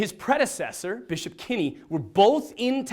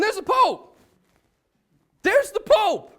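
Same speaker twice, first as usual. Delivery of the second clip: high, excited exclamation; raised-pitch thrilled disbelief; starstruck amazement; 11 semitones higher, 4 dB louder, slower than usual. Retold or imitated. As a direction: announce it as if you can't believe who's there. Relives first sight of the Pope.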